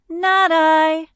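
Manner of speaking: high, loud monkey voice; bright-eyed monkey. A narrator's reading. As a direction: pipe up like a little monkey. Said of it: "said the bright-eyed monkey".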